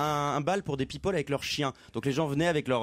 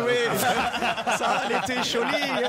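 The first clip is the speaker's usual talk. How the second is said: higher, louder laughing mock German accent; 8 semitones higher, 4 dB louder than usual. Answering Pierre about his grandmother, in laughing mock German accent. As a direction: keep the German accent going, laughing through it. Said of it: "je peux vous faire un petit accent allemand".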